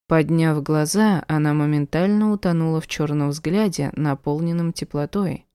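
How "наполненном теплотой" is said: usual speaking voice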